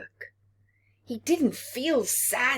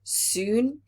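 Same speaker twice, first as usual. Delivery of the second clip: usual speaking voice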